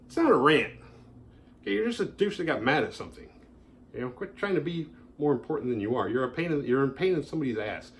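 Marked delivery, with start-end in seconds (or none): none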